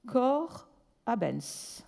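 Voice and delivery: "Cor abens" said very slowly, weight on the last syllable, a-BENS; drawn out, hitting "abens" on ens; stress on ens. Shown as a demonstration. Lecturer demonstrating a Latin phrase slowly, stress on ens. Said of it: "The, the stress on ens".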